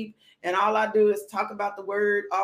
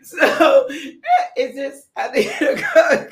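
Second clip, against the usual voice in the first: words breaking into giggles; laughing; 9 dB louder, slower than usual. Real, not acted. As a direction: talk while you can't stop laughing. Cracking up at herself.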